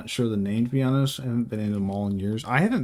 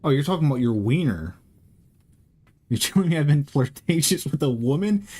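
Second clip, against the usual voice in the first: usual speaking voice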